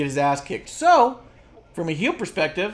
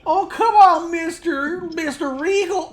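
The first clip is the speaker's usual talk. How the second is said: yokel voice; 14 semitones higher, 7 dB louder, slower than usual. Retold or imitated. Cameron Grimes whining to Regal in yokel voice. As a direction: whine it like a cartoon country boy. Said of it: "the yokel from Simpsons".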